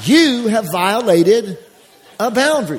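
"violated" stretched slow and loud; slow, loud emphasis on "you have violated"; stress on violated. Real, not acted. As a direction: lean hard on "violated" then "a boundary".

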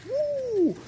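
a sudden high-pitched woo; a squeaky woo after the smack. Retold or imitated. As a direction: yelp it up high, amused. Reacting to the imagined slap.

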